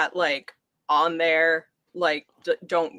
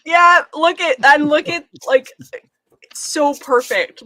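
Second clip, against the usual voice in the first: loud, high delight; gushing with real excitement; excited; 9 semitones higher, 9 dB louder than usual. Real, not acted. Excited over Barbie Shark poster.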